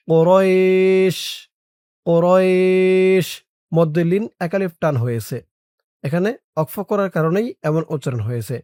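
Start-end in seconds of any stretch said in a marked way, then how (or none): 0.1-3.3 কুরাইশ stopped on the শ, long ই drawn slowly; pausal pronunciation. Shown as a demonstration. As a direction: drag the ই in কুরাইশ, end on a stop. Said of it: "এটার উচ্চারণ হয়েছে এখানে অক্ফ করার কারণে". (অক্ফ করে কুরাইশ, ধীরে টেনে)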